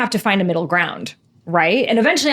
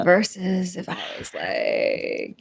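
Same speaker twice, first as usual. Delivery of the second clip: slow lazy drawl; 5 dB quieter, much slower than usual. Shown as a demonstration. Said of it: "even me just doing that".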